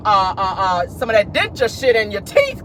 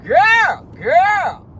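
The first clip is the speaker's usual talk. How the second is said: excited exclaim; 8 semitones higher, 4 dB louder than usual. Real, not acted. Exclaiming to Marlo.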